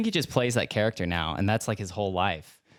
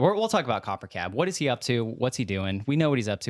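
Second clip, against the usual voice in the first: usual speaking voice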